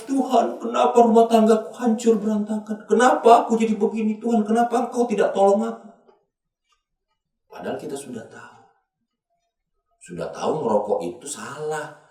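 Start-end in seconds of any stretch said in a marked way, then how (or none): 0.0-5.9 high-pitched mock wailing; acted tearful plea, held high; imitated crying prayer. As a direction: wail to God over a ruined marriage. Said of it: "kita nangis".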